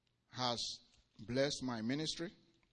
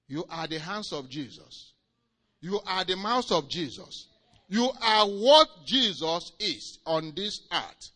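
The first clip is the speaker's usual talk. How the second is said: building louder, slow on the last line; preaching at full force; 4 semitones higher, 13 dB louder, slower than usual. Acted. Preacher telling believers they are Christ's hands, building cry. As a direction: rise to a proclamation.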